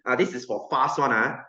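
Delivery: ordinary talk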